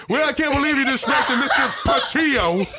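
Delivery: high, hammed-up scolding; imitating indignant principal. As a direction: exaggerate the principal's disappointment and botched name. Replaying the principal's mangled name, laughing.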